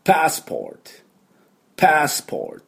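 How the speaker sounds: crisp teacher's demo of "パスポート" in English, pitched high; English pronunciation. Shown as a demonstration. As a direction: model "パスポート" as English passport, open "a", r-coloured "or". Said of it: "パスポートの正しい発音は".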